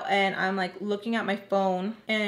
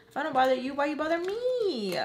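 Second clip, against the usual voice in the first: high, plaintive complaint; mock wounded whine; 7 semitones higher than usual. Inner reply to girls giggling about them, mock whine. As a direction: whine it up at the end.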